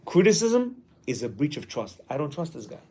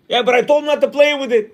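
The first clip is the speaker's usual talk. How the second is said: higher, louder indignant excuse; indignant parent; 7 semitones higher, 6 dB louder than usual. Retold or imitated. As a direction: defend yourself, annoyed. Parent complaining.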